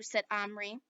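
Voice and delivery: plain reading voice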